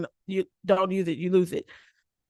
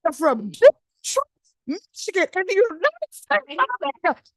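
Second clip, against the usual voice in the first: exasperated insistence; 11 semitones higher, 4 dB louder than usual. Retold or imitated. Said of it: "I was like".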